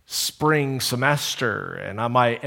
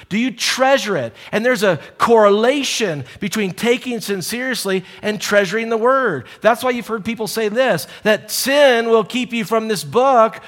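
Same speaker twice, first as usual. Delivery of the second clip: raised, pressing sermon voice; preaching; 8 semitones higher, 4 dB louder than usual. Acted. Pastor urging the church to treasure scripture, preaching hard. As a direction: drive the challenge home to the congregation. Preaching climb to full force.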